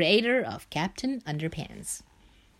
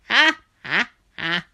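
loud villain cackle; evil laugh; 3 semitones higher, 5 dB louder than usual. Acted. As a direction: gloat with a big evil laugh.

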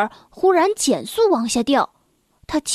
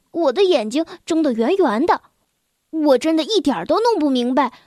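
ordinary delivery, nothing marked

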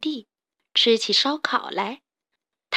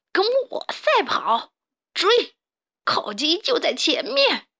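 high, drawn-out swooping cry; excited gloating boss; villain leading a chase; 8 semitones higher, slower than usual. Acted. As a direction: urge the gang on greedily.

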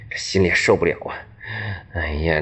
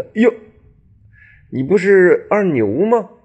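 surprised recognition; 12 semitones higher, 6 dB louder than usual. Acted. Master recognizes his apprentice.

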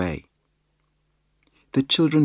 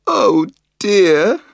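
high, louder storybook wail; 11 semitones higher, 8 dB louder than usual. A narrator's reading. Biff wails as the hat drifts away, mild wail. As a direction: wail in dismay, lightly acted. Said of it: "wailed Biff".

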